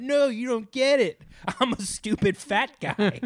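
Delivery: high-pitched, hammed-up pleading; put-on high voice of protest; mock protest. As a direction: protest high, then slow down on the admission. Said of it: "And then you go".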